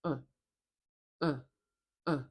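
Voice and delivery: short, neutral "uh", repeated separately; isolated "uh", tongue relaxed mid-mouth; schwa sound. Shown as a demonstration. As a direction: say "uh" as a weak, featureless vowel. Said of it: "make this sound".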